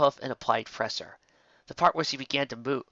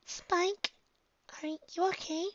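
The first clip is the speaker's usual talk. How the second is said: high, soft little whisper; faint whisper; 6 dB quieter, slower than usual. Acted. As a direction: ask softly so nobody else hears. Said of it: "a faint whisper".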